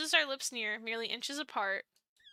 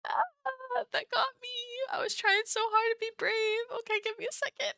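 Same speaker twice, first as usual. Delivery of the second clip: high, tearful wail; choked up and squeaky with tears; real tears, voice pushed up high; crying; 11 semitones higher, 4 dB louder than usual. Real, not acted. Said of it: "I'm crying so much".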